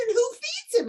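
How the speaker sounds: hammed-up startled squeal; mock alarm. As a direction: sound squeamish about feeding it. Retold alarmed question.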